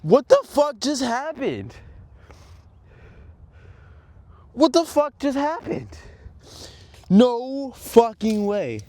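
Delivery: real high-pitched cry of disbelief; shocked disbelief. Real, not acted. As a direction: cry out in shock at what just broke. Just snapped his board's kingpin.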